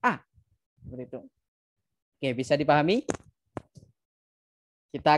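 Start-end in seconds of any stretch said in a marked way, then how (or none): 0.0-0.2 "A" pressed sharply downward; fourth tone. Shown as a demonstration. Teacher shows the falling fourth tone. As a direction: snap "A" down hard, like scolding someone. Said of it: "disentak untuk bunyinya nada keempat".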